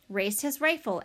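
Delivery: ordinary delivery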